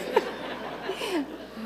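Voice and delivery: airy unvoiced laughter; high breathy laugh. Real, not acted. Laughing at the story.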